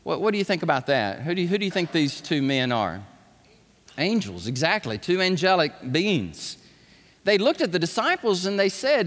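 usual speaking voice

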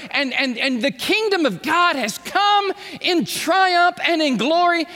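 rally the disciples like a commander; Jesus speaking. Retold or imitated. Preacher voicing Jesus' commission.

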